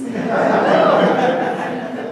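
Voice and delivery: high, breathy laugh. Real, not acted. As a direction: laugh out at the joke. Laugh at social justice course.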